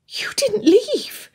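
high, slow, whispery gasp; breath-filled, high-pitched disbelief. A narrator's reading. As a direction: gasp the question in amazement. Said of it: "Anne gasped".

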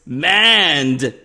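one word, loud and drawn out. Pretend. YouTuber saying a viewer's word on request, loudly. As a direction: belt out the single word on request. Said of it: "say in a loud voice".

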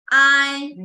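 I said clear and loud as a model; a teacher's model I, slow and distinct; model vowel sound. Shown as a demonstration. Teacher modelling pinyin sounds for the class, loud and clear. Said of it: "first one".

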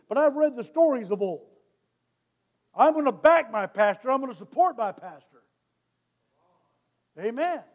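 loud, high preaching, fast and hard; full-force preaching. Acted. Pastor preaches to the church about standards, at full force. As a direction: drive it home from the pulpit.

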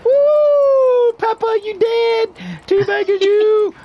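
high, loud gloating whoop; excited taunting yell. Real, not acted. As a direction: crow over the kill, loud and high. Taunting a killed enemy.

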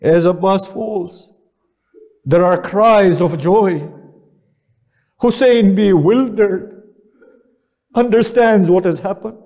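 elegy rising in pitch and force; mournful majlis recitation. Acted. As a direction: build the grief as you recite. Majlis recitation building to lament.

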